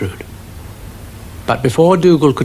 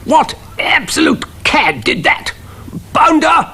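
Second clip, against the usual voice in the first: outraged cannon. A narrator's reading. Cannon demanding who fired it, outraged. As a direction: splutter with colonel's fury.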